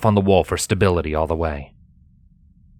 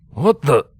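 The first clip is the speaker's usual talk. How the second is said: louder, higher startled half-question; 6 semitones higher, 4 dB louder than usual. A narrator's reading. Guard startled as light reveals the intruder. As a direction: blurt it out caught off guard. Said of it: "the startled guard".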